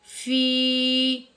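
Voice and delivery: फ़ी held long, very slow; stretched recitation. Shown as a demonstration. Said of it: "खींच कर पढ़ना है". (फ़ी को लंबा खींच कर)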